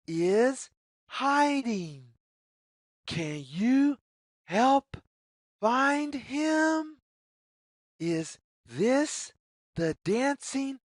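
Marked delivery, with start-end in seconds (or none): none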